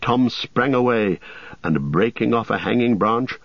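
ordinary delivery, nothing marked

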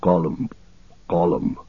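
a gulping swallow in the throat; throaty Gollum noise. A narrator's reading. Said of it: "he made a noise in his throat".

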